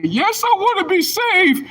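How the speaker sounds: very high falsetto eager yes; hammed-up believer voice; mock believer. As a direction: squeak it like a meek convert. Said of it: "You're gonna say".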